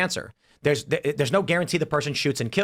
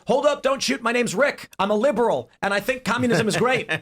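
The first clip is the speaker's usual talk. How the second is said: high, eager mock stranger; nervous stranger; 9 semitones higher than usual. Stranger Rick introduces himself to the gunman, high and eager. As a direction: hands up, rushing to explain himself. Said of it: "He says".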